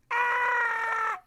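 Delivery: high piercing shriek; shrill terrified child scream; little girl's scream. Acted. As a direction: scream like a scared little girl. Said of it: "And the little girl said".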